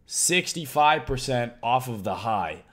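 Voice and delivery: ordinary talk, nothing marked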